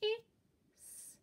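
"Is" split into its sounds, high and drawn out; sounded out. Shown as a demonstration. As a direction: stretch each sound of "Is". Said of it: "Let's try again".

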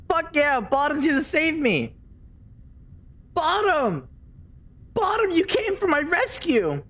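high, excited cheer; elated relief. Real, not acted. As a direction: hail your rescuer joyfully. Teammate arrives to save them.

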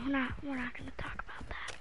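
unvoiced, quick whisper; whispered repeat. Real, not acted. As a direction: whisper it like a secret. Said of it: "repeats refusal whispered".

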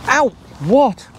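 sudden high ow; startled yelp. Acted. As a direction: yelp as if poked. Poked by the tent.

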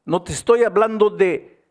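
loud, high, urgent preaching; impassioned preaching. Acted. Pastor urging the congregation, loud and high. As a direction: drive the point home from the pulpit.

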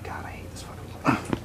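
whispering. Acted. Whispered aside after call.

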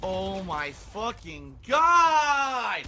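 real anger boiling over; frustrated outburst. Real, not acted. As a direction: stretch it out and explode on the last word. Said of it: "I'm a little frustrated".

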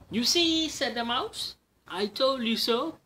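a smug little victory squeak; gloating mouse. Acted. Mouse gloats to the Gruffalo, high smug squeak.

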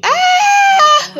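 high, loud sustained scream; re-enacted scream at full pitch; imitated scream. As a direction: let out a piercing held "ah". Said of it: "'cause I screamed so bad".

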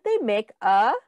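ordinary delivery, nothing marked

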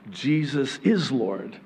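ordinary talk

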